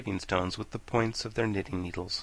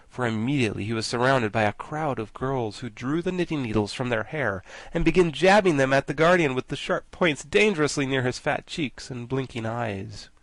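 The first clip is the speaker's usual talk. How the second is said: ordinary delivery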